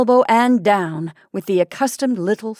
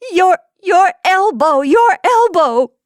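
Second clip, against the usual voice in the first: much higher and louder, slowing, frantic; wife pointing at husband's elbow, hysterically laughing and shrieking; hysterical laughter; 10 semitones higher, 8 dB louder, slower than usual. Acted. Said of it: "She was laughing now uncontrollably".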